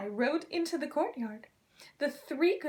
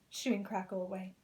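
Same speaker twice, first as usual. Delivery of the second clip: ordinary delivery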